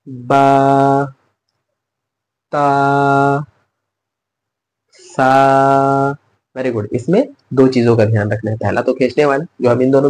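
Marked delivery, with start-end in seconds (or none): 0.1-6.2 बा, ता, सा each held long; drawn-out recitation. Shown as a demonstration. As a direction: recite the letters slowly, chanting each. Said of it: "शुरू से देखते हैं".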